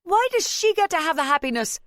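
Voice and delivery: high, loud jealous protest; anguished outburst. A narrator's reading. Wife accusing husband before the family, anguished. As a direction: cry out in hurt accusation.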